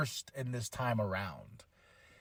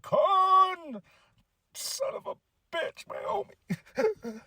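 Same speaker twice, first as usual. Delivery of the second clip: much higher, slow, strained put-on voice; a grieving Spock, hammed up for laughs; a high, drawn-out mock lament; 3 dB quieter, much slower than usual. Spock voiced in movie recap.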